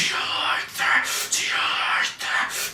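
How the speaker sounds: slow, breath-only repeated whisper; imitated whisper. Host mimics the singer alone after the riff, slow whisper. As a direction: whisper it eerily, stretching the words.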